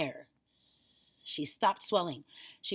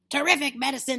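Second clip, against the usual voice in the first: high, loud exclamation; exultant cry; 8 semitones higher, 9 dB louder, faster than usual. A narrator's reading. Grandma delighted by the medicine, exultant cry. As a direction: crow it out. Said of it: "she cried".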